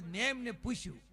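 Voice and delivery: ordinary talk, nothing marked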